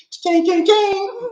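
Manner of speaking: high, playful money chime; cash register ching. Acted. Host joking about making it rain, high ching sound. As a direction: ring it out high, rising on the last.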